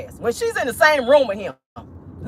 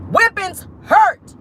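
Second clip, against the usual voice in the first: slow emphatic preaching; 8 semitones higher, 3 dB louder, much slower than usual. Acted.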